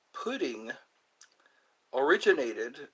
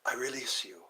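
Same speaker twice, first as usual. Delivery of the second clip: softer, husky movie-quote voice; Zach Galifianakis; 3 semitones lower, 6 dB quieter than usual. Retold or imitated. Quoting a movie character releasing someone, hushed impression. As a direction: imitate the film line in a hushed, put-on voice. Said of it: "Zach Galifianakis would say".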